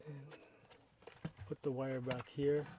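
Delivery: normal talking voice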